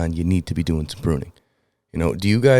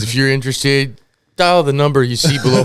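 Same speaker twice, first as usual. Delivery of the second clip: infomercial voice; 6 semitones higher, 5 dB louder than usual. Pretend. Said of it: "It's just an infomercial".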